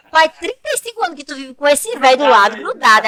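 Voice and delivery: high, loud mock exasperation; loud teasing protest. Daughter teasing mother about clinging to her husband, mock exasperation.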